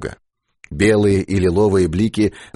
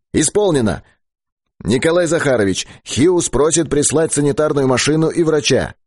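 high, raised radio operator voice; рявкающий репродуктор; 7 semitones higher than usual. A narrator's reading. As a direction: announce it over the loudspeaker, pitched well up. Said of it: "затем репродуктор рявкнул". (высокий отрывистый голос из репродуктора)

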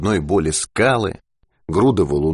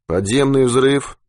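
slow, tongue-tied, half-asleep ask; slurred drowsy question; sluggish question; 7 semitones higher, slower than usual. A narrator's reading. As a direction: drag the words out, too tired to think. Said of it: "вяло спросил Быков".

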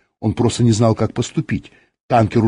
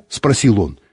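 ordinary delivery, nothing marked

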